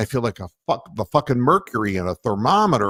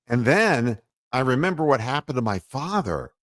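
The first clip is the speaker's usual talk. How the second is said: ordinary talk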